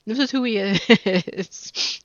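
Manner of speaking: loud and slow, broken by a laugh. Real, not acted. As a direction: crack up halfway through the sentence. Amused defence of the game character.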